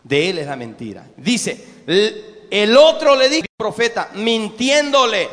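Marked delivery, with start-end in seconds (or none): none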